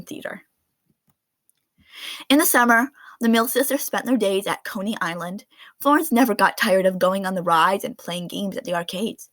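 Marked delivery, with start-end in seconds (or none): none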